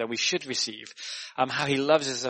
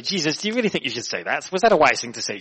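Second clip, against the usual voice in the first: mouthy Peter; 5 dB louder than usual. Acted. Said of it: "he's a bit, a bit mouthy".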